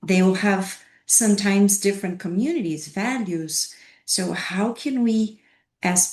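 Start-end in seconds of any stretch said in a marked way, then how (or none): none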